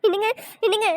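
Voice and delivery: acted weepy calling to a husband; tearful acting. Wife whimpering to her husband after a scolding, acted crying. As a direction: call out as if crying. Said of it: "எதுக்குடி அழுகுற".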